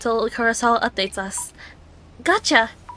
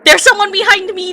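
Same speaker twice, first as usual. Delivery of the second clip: loud, higher alarmed cry; panicked shout; 6 semitones higher, 9 dB louder than usual. Real, not acted. Player sensing someone behind, loud panicked cry.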